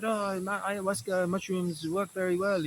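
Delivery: put-on quoting the French guy in a French accent. Vlogger mimics the French rapé seller in a French accent. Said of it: "so that's my French accent".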